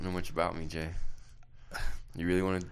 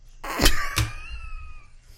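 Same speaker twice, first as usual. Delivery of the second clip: high squeaky laugh. Real, not acted. Laugh after his poem.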